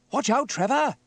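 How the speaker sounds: high, loud urgent call; calling out a warning. Acted. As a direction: shout to alert a driver. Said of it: "called Sam".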